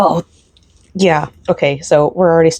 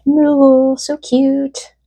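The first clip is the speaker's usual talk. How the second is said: delighted coo; 9 semitones higher, much slower than usual. Real, not acted. Delighted at cute item.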